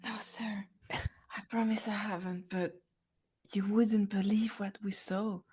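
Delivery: very soft, half-whispered answer; hushed reply. Acted. Answering the boss's whispered question.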